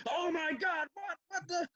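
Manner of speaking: very high-pitched frantic phone freak-out; hammed-up impression of a panicked man; Larry. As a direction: squeal it in mock disbelief. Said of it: "Larry was Larry on the phone".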